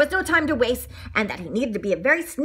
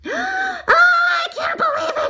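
high, loud gasp of disbelief; 5 dB louder, slower than usual. A narrator's reading. Donut appalled by baker's answer, gasping. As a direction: squeal it in appalled shock. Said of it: "Arnie gasped".

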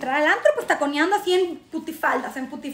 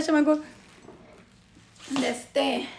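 ordinary talk

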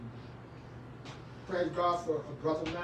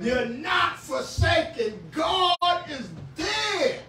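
higher, slow, shouted assurance; preaching shout; 9 semitones higher, 5 dB louder, much slower than usual. Acted. Pastor assuring persecuted believers, slow preaching shout. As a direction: lift them up with it.

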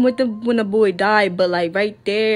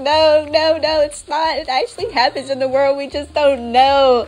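No, no, no pitched higher and louder, insisting; insistent denial; 5 semitones higher, 3 dB louder than usual. Retold or imitated. Arguing with herself that it really happens, insistent. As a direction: refuse to believe the comfort, firmly. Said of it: "But I'm like".

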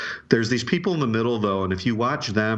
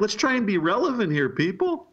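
exaggerated Southern accent impression, pitched up; 12 semitones higher than usual. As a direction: mimic a Louisiana political strategist, Southern accent. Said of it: "in a southern accent".

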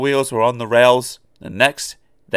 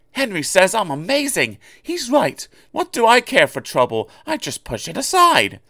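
higher, cocky engine voice; boastful little engine; 10 semitones higher than usual. Acted. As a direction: brag as if nothing can touch you. Oliver's boastful voice.

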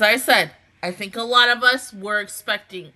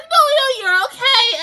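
mock consoling fans; 8 dB louder than usual. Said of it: "people are like".

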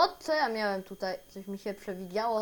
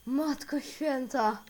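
slow, louder, high exclamation; real shock, raised voice; shaken by fright; 6 semitones higher, 6 dB louder, slower than usual. Real, not acted. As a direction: draw it out, still reeling from the scare. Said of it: "głowa mnie boli od tego strachu".

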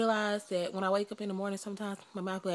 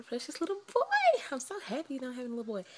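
higher, gushing then rushing; excited gushing; 5 semitones higher than usual. Real, not acted. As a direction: gush with joy over it. Gushing about having a boy.

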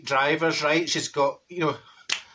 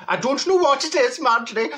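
high-pitched, badly wandering put-on accent; Neville's accent; 7 semitones higher, 3 dB louder than usual. Confused passenger to the driver, in put-on accent. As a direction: overdo the friend's accent, pitched well up. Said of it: "I'm not doing his accent".

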